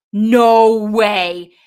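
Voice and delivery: loud burst of disbelief; surprised shout. Real, not acted. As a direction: blurt it out, stunned. Both women spot the match.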